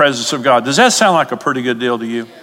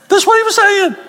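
much higher, laughing indignation; voice shoots up. Real, not acted. Preacher exasperated by the devil's lie, voice shooting up. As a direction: squeak up in exasperation. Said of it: "my voice goes up".

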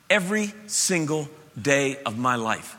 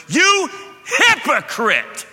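much higher, louder, slow stern You hypocrite with YOU hit hard; forceful rebuke; 11 semitones higher, 6 dB louder, much slower than usual. Retold or imitated. Jesus confronting the synagogue ruler, loud slow rebuke. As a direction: hurl You hypocrite like a blunt accusation. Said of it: "He went".

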